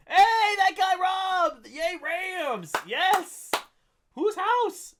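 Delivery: high, loud excited cheer. Real, not acted. Streamer cheering a chat message about the Rams. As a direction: whoop it up like a fan.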